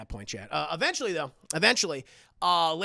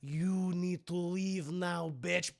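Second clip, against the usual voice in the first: slow, quiet menace; menacing girl villain; 5 dB quieter, slower than usual. Acted. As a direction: draw it out coldly before the attack. Esther menaces the nun.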